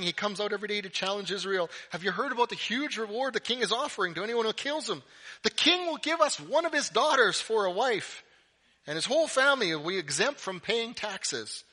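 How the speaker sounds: high, loud, quick buzz; excited soldier gossip. Acted. Men telling David about the king's reward, excited. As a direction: spread thrilling news of a big reward.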